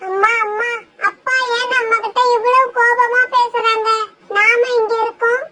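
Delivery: put-on high, upset little girl's voice; a small child close to tears asking why; tearful child. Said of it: "beauty, அழாத".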